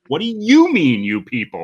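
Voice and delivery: high mocking copy of the question; Al Pacino's echo. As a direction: jump way up on What do you mean, then drop. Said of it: "we hear Al Pacino going".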